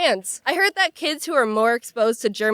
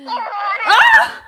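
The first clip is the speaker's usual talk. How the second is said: sudden loud startled shriek; startled scream; 11 semitones higher, 8 dB louder than usual. Real, not acted. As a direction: yelp in fright. Toy startles her.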